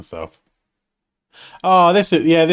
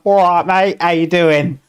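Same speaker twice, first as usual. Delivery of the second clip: loud, high-pitched mock Cockney greeting; put-on Cockney, pitched up and belted out; 7 semitones higher, 7 dB louder than usual. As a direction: hail a mate in hammed-up Cockney. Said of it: "like, a Cockney".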